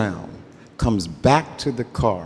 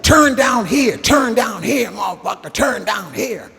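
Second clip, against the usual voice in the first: urgent shouting; 12 semitones higher than usual. Retold or imitated. G-Man directing the driver down a one-way street, high urgent yelling. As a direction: keep yelling directions in a panic. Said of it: "He's like".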